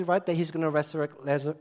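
ordinary talk

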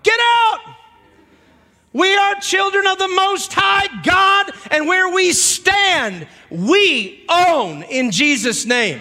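high, loud and slow, shouting down the devil; full-force preaching shout; preacher's shout. Acted. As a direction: roar it out like casting out a demon. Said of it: "I just wanna shout 'em out".

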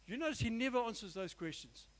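ordinary delivery

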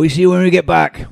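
high farewell call; pretend calling back. Player calling goodbye from the departing cart, high pretend call.

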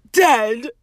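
loud, gloomy pronouncement. A narrator's reading. Hagrid answering about the Flobberworms, gloomy. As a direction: drop the bad news heavily and loudly. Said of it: "said Hagrid gloomily".

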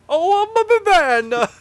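high, slow, loud silly boast; mock macho line. Podcaster quoting a friend, slow high mock boast. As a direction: puff it up like a goofy tough guy. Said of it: "as someone I know might say".

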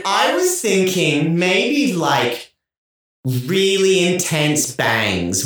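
slow dramatic pitch. Acted. Dramatic bangs suggestion bit.

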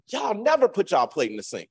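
high-pitched exasperated parent complaint; mock scolding. Parent to children about dishes, mock scolding.

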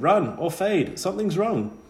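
high, loud alarmed cry; urgent warning. Acted. Ghost girl Lisa warning Bod of danger, high loud alarm. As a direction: sound the alarm, sharp and high.

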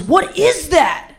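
puzzled complaint, leaning on IS; bothered. Retold or imitated. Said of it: "he, he seems bothered".

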